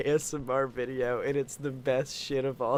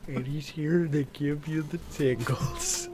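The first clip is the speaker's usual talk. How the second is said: usual speaking voice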